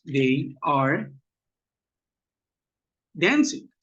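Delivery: slow, with a long pause before "dancing"; word by word. Shown as a demonstration. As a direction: draw out "they are", pause, then give "dancing". Models example sentence piece by piece. (palabra por palabra, muy lento)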